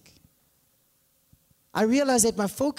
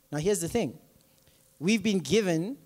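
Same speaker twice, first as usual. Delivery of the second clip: usual speaking voice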